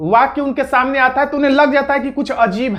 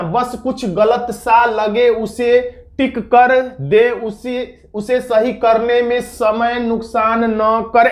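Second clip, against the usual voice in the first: slow careful reading; much slower than usual. Shown as a demonstration. Teacher showing students how to read each word, slow and deliberate. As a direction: read the rule out slowly, word by word. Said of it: "जैसे ये लिखा है तो ऐसे पढ़ना".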